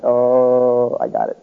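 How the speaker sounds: long drawn-out "oh" of understanding; dawning realization. Retold or imitated. Preacher retelling his answer to God, dawning realization. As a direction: let it slowly sink in.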